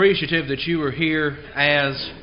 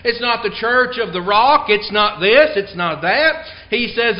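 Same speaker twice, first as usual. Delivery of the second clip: ordinary delivery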